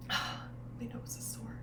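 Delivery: hushed whisper with a stuffy nose; soft sniffly whisper between sighs. Real, not acted. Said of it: "I'm sniffly".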